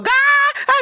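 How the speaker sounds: very high shrieks of alarm; hammed-up bystander screams; mock screaming. Onlooker joining a commotion, very high mock screams. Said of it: "sumisigaw siya".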